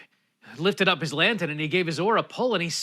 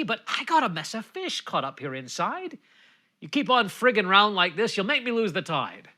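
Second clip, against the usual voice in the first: exasperated Charlie; 6 semitones higher than usual. Acted. Charlie to the old man of the sea, high exasperated plea. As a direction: beg the old man to leave him be.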